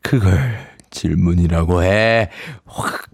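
low grumbling creature growl; grumpy sand fairy threat. Acted. 모래 요정이 앤시어에게 으르렁대며. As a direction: mutter the threat.

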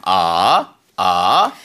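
"아" rising from low up to mid; Mandarin second tone. Shown as a demonstration. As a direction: slide "아" upward like a question. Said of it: "이성은 와이퍼 동작".

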